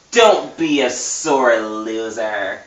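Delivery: slow, taunting camp drawl; a light camp villain taunting the hero; campy villain voice. Acted. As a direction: sneer it at him, lingering.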